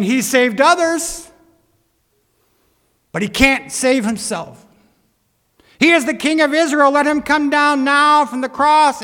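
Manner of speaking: loud, high, scoffing challenge; sarcastic mockery. Chief priests and elders mocking Jesus on the cross, sarcastic. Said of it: "being sarcastic in their tone of voice".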